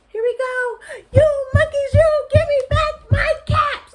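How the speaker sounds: very high, very loud yell; really very angry. Acted. Furious peddler jumps and yells at the monkeys, very high. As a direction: jump and yell for the caps. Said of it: "the peddler was really very angry".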